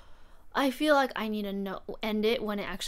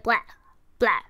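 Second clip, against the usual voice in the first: trader noise; 7 dB louder than usual. Retold or imitated. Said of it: "were they like".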